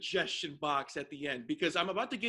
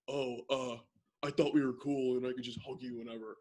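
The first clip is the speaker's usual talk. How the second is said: sheepish, hesitant imitation, pitched down; awkward; 7 semitones lower than usual. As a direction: imitate an awkward guy backing off. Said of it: "so freaking awkward".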